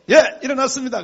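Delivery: loud, high, acted phone answer; over-alert reply, hammed up; feigned wakefulness. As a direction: pretend you were wide awake all along. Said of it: "자다가 전화 받는 걸 또 감추려고".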